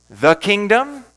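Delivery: emphatic "The", as in the one and only; stress on the. Shown as a demonstration. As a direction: punch "The" before "kingdom". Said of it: "emphasize each word individually".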